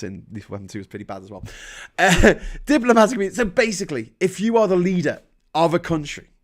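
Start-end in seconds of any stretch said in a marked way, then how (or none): none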